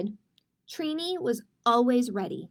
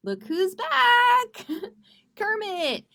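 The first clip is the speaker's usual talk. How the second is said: high, slow, delighted "look who's back"; excited greeting; 9 semitones higher, 7 dB louder, slower than usual. Real, not acted. Librarian to children, revealing Kermit with delight. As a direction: announce the special guest with glee.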